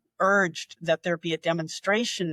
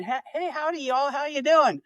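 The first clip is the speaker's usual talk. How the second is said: folksy Southern greeting; 6 semitones higher than usual. Retold or imitated. Southern twang mocking a rustic toast to the king.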